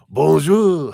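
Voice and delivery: hammed-up French accent, high and loud; a playful French "Bonjour" in a jokey French accent; mock French accent. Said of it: "all of my accents either turn into someone from ... France".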